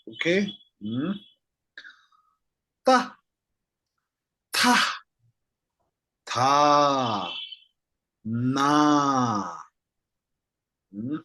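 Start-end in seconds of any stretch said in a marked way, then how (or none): 2.9-9.6 Ta Ta Ta then Na, drawn out; slow drill of Ta then Na; syllable drill. Shown as a demonstration. Teacher to class, drilling each sound slowly. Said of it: "I will read them one by one".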